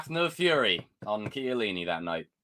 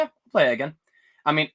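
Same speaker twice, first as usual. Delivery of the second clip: usual speaking voice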